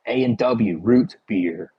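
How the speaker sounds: root beer spelled out slowly; slow clarifying quote. Retold or imitated. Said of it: "he's like".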